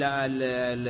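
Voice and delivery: ordinary delivery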